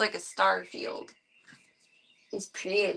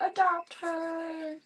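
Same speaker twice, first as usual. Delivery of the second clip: slow plea; 8 semitones higher, 3 dB quieter, much slower than usual. Shown as a demonstration.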